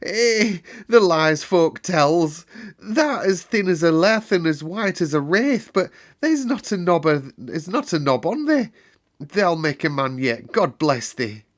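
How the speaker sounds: put-on old gardener weeping with joy; tearful old man. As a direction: choke it out in wonder. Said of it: "tears ran down".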